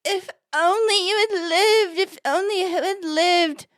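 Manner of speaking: high, slow, hammed-up wail; drawn-out fake graveside lament; mock crying. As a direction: wail it like a badly acted mourner. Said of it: "he's crying and says".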